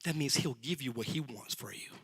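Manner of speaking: ordinary talk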